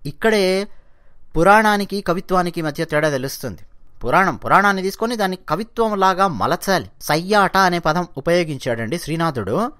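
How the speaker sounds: ordinary delivery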